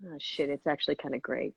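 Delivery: low, very soft mock aside; hushed and low, as if reluctantly won over; grudging admission. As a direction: imitate skeptics quietly conceding, under the breath. Grudging crowd reaction, acted out.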